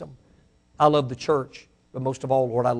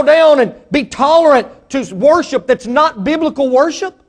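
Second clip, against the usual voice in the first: fiery preaching; 12 semitones higher, 9 dB louder than usual. Real, not acted. Preacher thundering at the congregation about tolerance, high and loud. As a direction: rail against sin at full voice.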